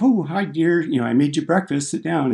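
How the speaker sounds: sweet mom. Retold or imitated. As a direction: play the cheerful mom making breakfast. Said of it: "her mom was like".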